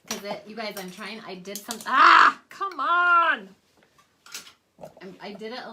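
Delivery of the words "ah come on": sudden high, loud cry of annoyance; frustrated outburst. Real, not acted. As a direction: yell it out, stretched and exasperated. Sudden mishap while doing makeup.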